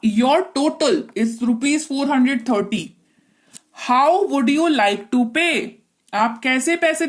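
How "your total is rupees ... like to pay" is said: slow, clearly spaced clerk's question; slow for learners. Shown as a demonstration. Teacher voicing the ticket clerk for students, slow. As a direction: lay each word out slowly. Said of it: "मैं धीरे बोल रही हूं".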